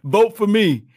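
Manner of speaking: squeaky self-promoting athlete, pitched high and pushed loud; mock begging player. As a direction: ham up a needy star begging for votes. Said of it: "You think that he had to say".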